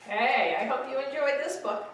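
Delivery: plain reading voice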